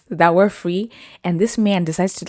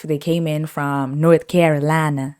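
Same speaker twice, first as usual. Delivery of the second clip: ordinary talk, nothing marked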